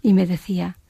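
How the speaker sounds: usual speaking voice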